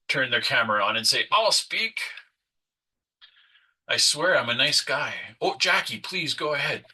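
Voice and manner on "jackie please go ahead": usual speaking voice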